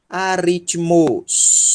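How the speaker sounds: Aritmos said slowly, stress on rit; slow model word. Shown as a demonstration. Teacher repeating Aritmos slowly for the class.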